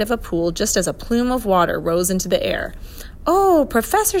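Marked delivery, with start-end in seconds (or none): 3.3-4.2 put-on high, loud girlish call; excited high-pitched exclamation; crying out. A narrator's reading. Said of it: "she cried".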